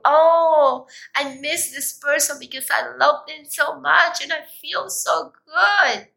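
swooning mock outpouring of love; gushing, lovesick example. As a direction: gush like you adore someone. Acted example of positive emotion.

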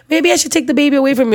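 a nurse chirping innocently; lightly voiced chirpy nurse; sweet nurse voice.

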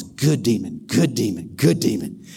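low, pleased devil praising his imp. Acted. Devil to his demon, low and pleased. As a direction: purr low approval like the devil.

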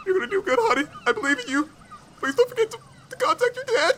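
high, choked-up squeak; hammed-up tearful farewell from a dad; trying not to cry. Dad seeing his daughter off, fighting back tears. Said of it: "he's trying his best not to cry".

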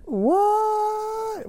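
mock surprise. Mock shock at a pun.

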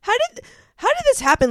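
leaps up high and fast in disbelief; disbelieving shriek. Retold or imitated. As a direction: squeal it like you can't believe your eyes. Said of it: "And I was like".

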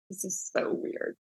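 a hushed whisper of disbelief; whispered aside. Retold or imitated. Thinking aloud after the boss's odd order, whispered. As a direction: breathe it out to yourself, amazed. Private reaction to strange order.

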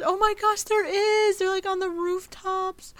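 high, excited squeal. Real, not acted. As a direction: gush over something adorable. Excited about hidden details.

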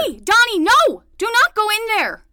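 loud, high, urgent call to stop; shouting a warning. Acted. Nanny yells to stop Donnie going inside, loud and high. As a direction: yell at him not to go in. Nanny yelling at boy entering.

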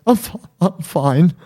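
high, halting, stammered through mock tears; imitated crying. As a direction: act out choking back sobs while insisting. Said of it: "I was just crying uncontrollably".

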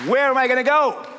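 preacher turning the doubt into a defiant shout; loud declaration. Real, not acted. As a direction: fling it out to the church as a challenge. Preacher declaring his answer.

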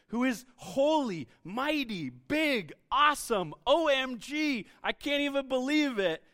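bubbling over with awe, voice lifted; O M G spelt out slowly, wide-eyed; excited gushing. Acted. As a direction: rattle it off like a thrilled teen. Gushing over God's greatness.

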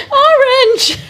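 high squealed laugh on the word; giggling high-pitched exclamation; laughing shout. Real, not acted. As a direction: burst out laughing as you say it.

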